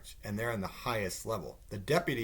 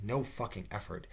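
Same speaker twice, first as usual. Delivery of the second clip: ordinary delivery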